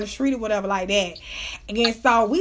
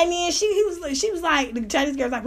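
plain reading voice

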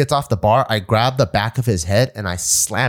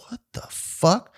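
incredulous; 8 semitones higher, 5 dB quieter, slower than usual. Retold or imitated. Shocked at the taunt.

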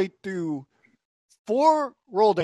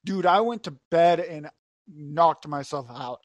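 ordinary talk

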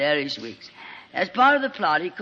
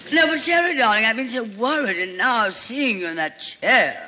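higher, breathy gushing actress; gushing Lorraine; 4 semitones higher, 3 dB louder than usual. Acted. Lorraine greeting injured Sheridan, gushing and theatrical. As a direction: fawn over him like a melodramatic diva.

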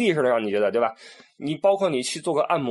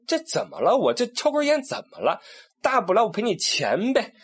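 much higher, indignant what's-the-problem; defiant rich tourist; 10 semitones higher than usual. Retold or imitated. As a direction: challenge them, money solves it. Rich tourists defying the ban. (不服气地顶回去，大不了赔钱)

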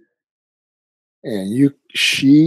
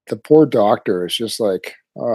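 ordinary talk, nothing marked

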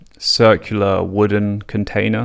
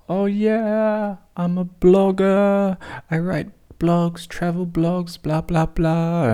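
high, slow, innocent blogger patter; prepped lines; 10 semitones higher, slower than usual. Retold or imitated. Telling police the prepped blogger story, high and innocent. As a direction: recite the cover story sweetly. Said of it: "I have my lines prepped".

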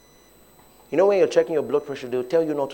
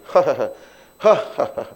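mock laugh; 7 dB louder, faster than usual. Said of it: "Now you now say".

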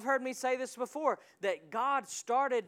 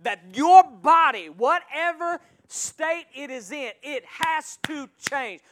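slow, louder, climbing preacher push; slow pounding sermon build; fiery preaching; 3 semitones higher, 10 dB louder, slower than usual. Real, not acted. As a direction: drive it home to the congregation. Preaching at full force.

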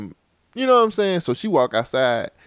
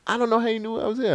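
imitating girlfriend; 12 semitones higher, faster than usual. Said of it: "so she was like".

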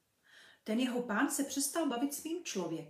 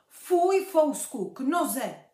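sharp, much louder and higher call to the dog; shouted command; 5 semitones higher, 10 dB louder, slower than usual. Acted. The master shouts at his dog to heel, loud command. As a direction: yell the dog back to heel. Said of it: "pán vykřikl".

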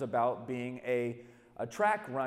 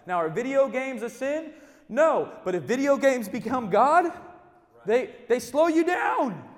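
loud, rising, slowed preaching; preaching at full force; 8 semitones higher, 10 dB louder than usual. Acted. Preacher urging the crowd to throw off weights, loud and high. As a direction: drive it home to the congregation.

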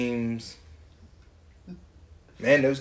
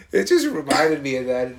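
normal talking voice

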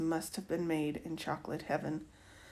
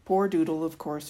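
ordinary delivery, nothing marked

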